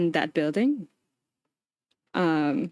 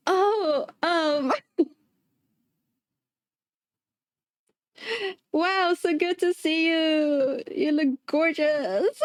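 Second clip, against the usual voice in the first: high, slow nervous flattery; awkward fake cheer; 7 semitones higher, much slower than usual. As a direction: fake delight with a laugh. Awkward reply to client.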